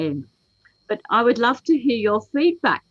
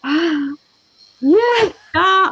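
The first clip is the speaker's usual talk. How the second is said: mock out-of-breath gasps, higher and louder; a hint of breathless panting; panting parents; 8 semitones higher, 7 dB louder, much slower than usual. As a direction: imitate puffing parents. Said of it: "I can still see some people going".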